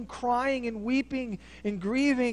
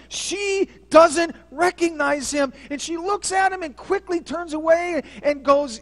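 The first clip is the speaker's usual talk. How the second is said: climbing louder and higher, slowing on key words; building preaching; 6 semitones higher, 8 dB louder than usual. Acted. Preacher building the congregation toward Mary's grief, swelling louder. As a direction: preach it up to a peak.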